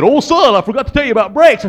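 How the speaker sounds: loud, hurried imitation of Dad; dad calling out. Dad calls to his fallen son, belatedly remembering. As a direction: blurt it out as a father remembering too late. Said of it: "My daddy said".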